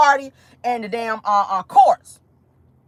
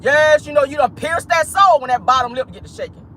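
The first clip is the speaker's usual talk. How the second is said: ordinary delivery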